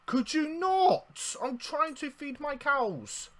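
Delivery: high, loud, slow exasperated yell at the attacker. Real, not acted. Gamer yelling at the mob that is hurting his cows, high and loud. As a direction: shriek it out in outrage, drawn long.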